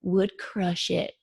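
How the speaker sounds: imitated sweet Southern coach, drawn out slowly; slow, sincere you-can-do-it in a sweet voice; sweet little voice. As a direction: look them dead in the eye, sweetly encouraging. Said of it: "Just like that, in that sweet little voice".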